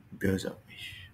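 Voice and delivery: a soft whispered repeat; whispered example. Shown as a demonstration. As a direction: whisper Böse auf mich under the breath.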